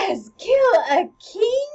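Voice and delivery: loud, high, slow disbelieving question; shocked queen. Acted. Queen echoing Hamlet's accusation, loud high shock. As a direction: cry it out, rising in disbelief. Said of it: "the queen is like".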